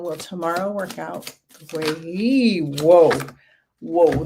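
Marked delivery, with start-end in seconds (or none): 2.6-4.2 startled, louder and drawn-out whoa; surprised whoa. Real, not acted. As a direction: caught off guard as a card jumps out. Tarot card flips out suddenly.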